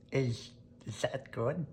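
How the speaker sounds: hesitant duck voice; lightly voiced puzzled duck. Duck voice in story.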